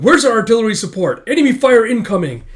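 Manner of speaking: loud, high urgent shouting; battlefield alarm. Acted. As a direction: yell for support under fire. Soldier yelling under fire.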